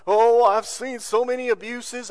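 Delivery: put-on wail of an objector; mock objector. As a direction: imitate people objecting, drawn out and loud. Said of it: "I hear what everybody says".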